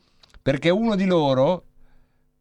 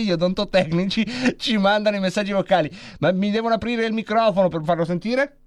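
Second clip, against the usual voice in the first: ordinary talk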